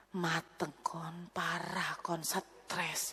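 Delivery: whispered, low and slow; thinking to himself, whispered; inner thought. Real, not acted. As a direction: whisper it to yourself. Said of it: "dalam hati gini".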